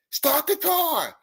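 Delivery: high, drawn-out strained mock scream; imitated screaming. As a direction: act out a desperate cry without full volume. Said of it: "came running out the woods screaming".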